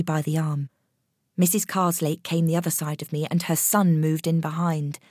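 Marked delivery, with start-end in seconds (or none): none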